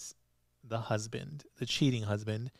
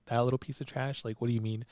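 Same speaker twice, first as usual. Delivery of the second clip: usual speaking voice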